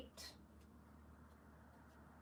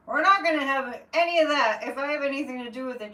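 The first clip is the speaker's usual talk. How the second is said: loud, quick, higher-pitched objection; mock defiance; 4 semitones higher, 9 dB louder, faster than usual. Confronting the kid waving the flag, loud playful defiance. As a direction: put your foot down, loud and fast. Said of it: "I'm like".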